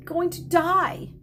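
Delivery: fighting not to cry; holding back tears. A narrator's reading. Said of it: "keep back your tears".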